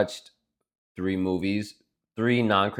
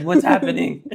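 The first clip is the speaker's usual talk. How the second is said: high, louder bewildered question; mock confusion; 9 semitones higher, 7 dB louder than usual. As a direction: play dumb, faking shock. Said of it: "It's like".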